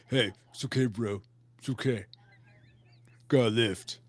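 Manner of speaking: deep, low muscle-man bro voice; Paul's gym-bro voice. Retold or imitated. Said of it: "Paul does this same thing to me all the time with his muscle man".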